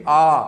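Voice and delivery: आ held long and loud; long aa sound. Shown as a demonstration. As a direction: sing out a long open आ. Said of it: "इसकी आवाज़ कैसे निकलेगी". (लंबी खुली आवाज़ आ)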